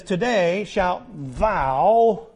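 very slow, stretching every word of Today shalt thou; drawn-out quote. Acted. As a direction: linger on "thou", spelling it out. Preacher drags quoted verse.

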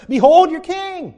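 proclaiming loudly. Acted. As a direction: announce him to the crowd. Pilate presents Jesus to crowd.

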